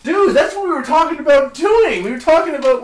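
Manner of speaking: high, loud burst of excitement; excited. Real, not acted. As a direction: blurt it out, thrilled and laughing. Excited realization, laughing.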